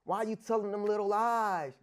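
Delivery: ordinary talk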